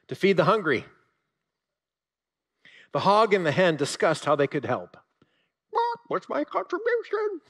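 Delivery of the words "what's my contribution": very high, squeaky hen voice; hen imitation. Preacher doing a hen asking her share in a high squeak. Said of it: "my best hen imitation".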